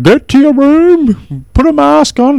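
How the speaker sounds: high, loud, drawn-out yell; mock panicked order. Ordering the sick one away, high mock-panicked yell. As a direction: shriek it high, like banishing someone infectious.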